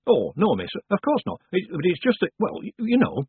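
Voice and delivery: high, stumbling denial; flustered. A narrator's reading. Mrs Carter caught leaving a request, flustered and high. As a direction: trip over the words in embarrassment. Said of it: "The woman suddenly looked flustered".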